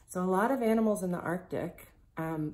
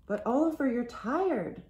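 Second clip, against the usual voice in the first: high, raised voice of worry; higher, pleading storybook voice; concerned protest; 8 semitones higher, 5 dB louder than usual. A narrator's reading. Girl worries for her tired friend.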